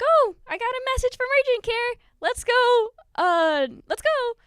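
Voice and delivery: high, loud eager call to get going; excited hurry. Acted. Family hearing back from urgent care, loud high eager hurry.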